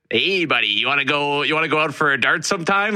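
put-on light Canadian hockey bro accent, upbeat. Mimicking Americans who copy the Canadian hockey accent. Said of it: "Like, that kinda accent".

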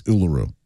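"Uluru" as oo-luh-ROO; clear, corrective "Uluru"; proper pronunciation Uluru. Shown as a demonstration. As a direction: give "Uluru" its proper oo ending. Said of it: "its proper name is".

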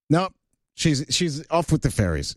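usual speaking voice

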